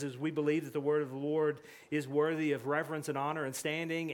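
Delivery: ordinary delivery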